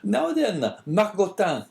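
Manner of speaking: high, loud call to the dog; innkeeper scolding dog. A narrator's reading. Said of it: "cried he, speaking to the dog".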